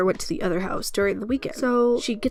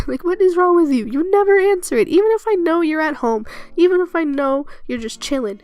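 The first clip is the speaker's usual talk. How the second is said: exasperated loud rant; 7 semitones higher, 5 dB louder than usual. Real, not acted. Ranting at grandma about phone.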